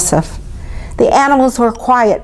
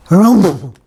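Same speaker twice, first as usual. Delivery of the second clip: loud rumbling camel harrumph; camel snort; 5 dB louder than usual. A narrator's reading. Humpy camels complaining, loud snort. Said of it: "snorted the humpy".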